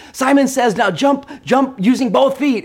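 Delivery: mimicking daughter. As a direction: call out to the kids like the game leader.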